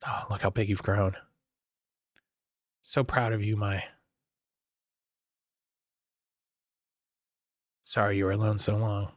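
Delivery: low, soft fatherly voice; tender robot dad. Acted. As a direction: read it low and gentle like a proud father.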